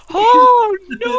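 high, loud mock wail; horrified cleaner. Imagining the cleaner finding the bin, hammed-up horrified wail. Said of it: "imagine the cleaner just going".

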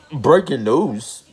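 high, slow, dramatic breaking-news call; mock news announcement. Host jokingly announcing sports news to listeners, mock newscaster. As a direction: announce it like a newscaster, tongue in cheek. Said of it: "It's not really breaking news".

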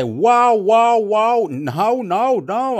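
loud, high wow wow then no no no; arguing protest. Retold or imitated. Arguing with the Argentinian guy over repair costs, loud high protest. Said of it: "I was arguing with him".

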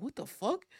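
mock shock; shocked reaction. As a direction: react stunned. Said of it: "I was like".

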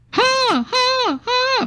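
very high, sing-song ha ha ha; mock Storm laugh; Storm's laugh. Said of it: "from Storm".

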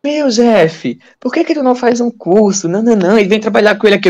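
a touch of a nagging coworker; imitating acquaintances. People urging him, retold.

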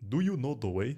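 mock Ugandan accent meme voice. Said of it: "in a Ugandan accent".

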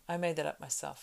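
ordinary delivery, nothing marked

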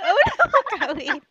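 high, loud gasping repeat of the news; surprised echo. Real, not acted. Friend hears her guest is about to marry, surprised. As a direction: echo it back in delighted disbelief. Echoing news she's getting married.

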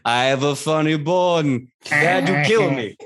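high-voiced talking skull in a put-on voice; jokey skeleton. Skeleton reassuring the room it's not evil, jokey. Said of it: "And then the head goes".

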